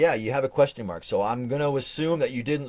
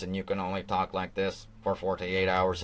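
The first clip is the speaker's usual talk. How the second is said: robot voice; 6 semitones lower, 6 dB quieter than usual. Pretend. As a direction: lower mock robot voice, a bit softer. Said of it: "makes you talk like a robot".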